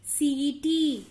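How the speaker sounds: "सीटी" slowly, both ee sounds held; long vowel. Shown as a demonstration. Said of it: "ई देर तक हो रहा है".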